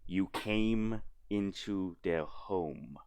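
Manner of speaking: menacing threat. Acted. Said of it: "she's just like".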